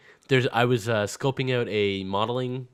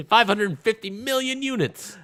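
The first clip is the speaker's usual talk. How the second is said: high, drawn-out exaggerated boast; hammed-up amazement, laughing into it; mock hype announcement; 13 semitones higher, 5 dB louder, slower than usual. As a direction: blow the number up, high and stretched. Mock-correcting the exaggerated number.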